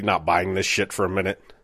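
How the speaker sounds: ordinary delivery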